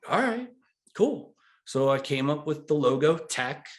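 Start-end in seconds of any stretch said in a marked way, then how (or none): 0.1-1.4 pleased. Retold or imitated. His own delighted reply.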